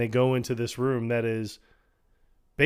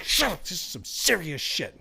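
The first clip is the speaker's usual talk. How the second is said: mock stern shutdown; 5 semitones higher than usual. Stern hush reply.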